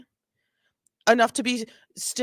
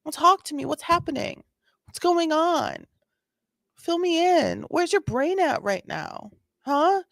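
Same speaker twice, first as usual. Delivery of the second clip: higher, lightly voiced patient officer; mock gentle cop; 7 semitones higher than usual. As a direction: coax her like a patient cop. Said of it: "He said".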